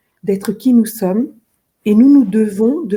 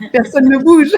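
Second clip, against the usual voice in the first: dit en éclatant de rire; en riant; 5 semitones higher, 6 dB louder than usual. Real, not acted. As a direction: rire franc en lâchant la phrase. Laughing about January in her industry. (en riant, personne ne bouge en janvier)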